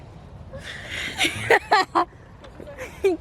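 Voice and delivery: loud high burst of laughter; big laugh. Real, not acted. Mom laughs at daughter's request.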